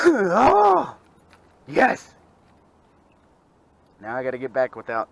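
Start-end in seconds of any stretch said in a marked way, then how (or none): 0.1-2.1 high, loud gleeful cry; real joy. Real, not acted. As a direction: cry out in delight. Cheering another fish.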